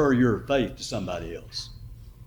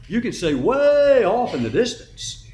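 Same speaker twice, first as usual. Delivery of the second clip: ordinary talk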